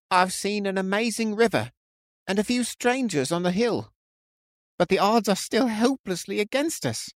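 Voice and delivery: high, strained, despairing protest; cracking in distress. A narrator's reading. Frightened man telling his friend the odds are hopeless, distressed. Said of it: "his voice cracking in distress".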